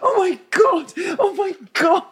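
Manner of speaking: very high, loud mock sobbing; hammed-up wailing. Retelling how she left that group, high mock-crying wail. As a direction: wail it through laughter, drawn out.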